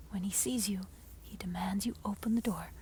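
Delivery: ordinary delivery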